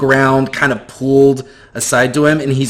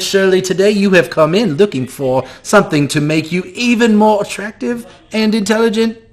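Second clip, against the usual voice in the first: turning on charm; 6 semitones higher than usual. Acted. Mikas greeting a wealthy customer, oozing salesman charm. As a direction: lay the charm on thick for a rich customer. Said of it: "turns on the charm".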